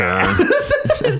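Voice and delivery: squealing high laugh; laughing yeah. Real, not acted.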